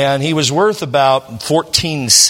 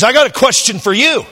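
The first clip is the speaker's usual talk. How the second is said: friend's voice pitched up; high, pushy challenge from a friend; voicing Bernie; 9 semitones higher, 4 dB louder than usual. Friend confronting the pastor, pitched high.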